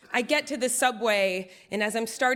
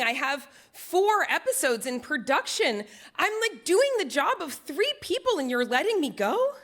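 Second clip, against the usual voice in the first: halting questions building into high-pitched indignant protest; stunned disbelief; 6 semitones higher than usual. Retold or imitated. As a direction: stammer in disbelief, then protest with pitch soaring. Acts out hearing she is fired.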